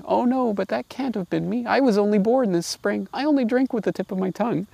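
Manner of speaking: high mocking imitation of a meek lamb; mocking polite lamb. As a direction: parody the naive lamb pleading politely. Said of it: "calmly answering and politely, uh, retorting".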